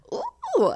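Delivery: very high cooing "ooh"; playful ooh. Pretend. Showing off heart glasses, playful high ooh. Admiring her own festive outfit.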